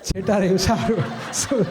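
chuckling through the words; amused, laughing at the situation; a real laugh in the voice. Real, not acted. Laughing at family quarrels.

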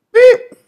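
a sharp, high, loud imitated car horn; mock horn beep. Man recalling his ex-wife arriving honks like her car horn.

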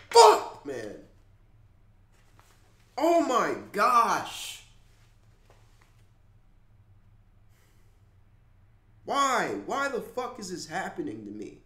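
loud, high despairing cry; anguished outburst. Real, not acted. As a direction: cry out in grief. Grief outburst over a death.

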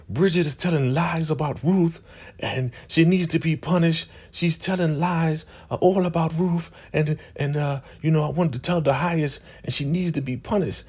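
high, whiny tattling; high old lady whining blame; whiny high accusation, put on; whiny accusing old lady. Elder Mother flipping the script.

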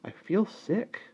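small, high, pitiful voice; sick child. A narrator's reading. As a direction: complain feebly as an unwell child. Child tells mother she's sick.

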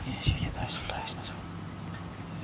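soft whisper egging the cat on. Real, not acted. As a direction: breathe it under your breath.

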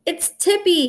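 high, startled, the bike wobbling; alarmed cry. A narrator's reading. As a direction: yelp as the bike tips. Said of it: "he cried".

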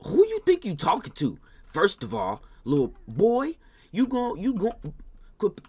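high, loud put-on parent scolding; mock angry scolding. Babysitter scolds the baby who won't go upstairs, high loud parent scold. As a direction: scold the little boy like an outraged parent.

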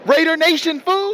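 Raider Nation, fool, called out high; hype call. Real, not acted. Fan hyping Raider Nation at stadium, high hype call. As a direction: shout it like a proud fan.

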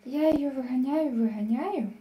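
normal talking voice

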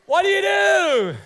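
high, slow, ringing shout; preaching shout. Real, not acted. Preacher crying out to the congregation, slow high shout. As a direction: hit the question at full force.

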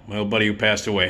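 normal talking voice

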